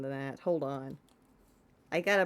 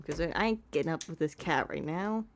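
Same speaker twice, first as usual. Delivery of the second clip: ordinary talk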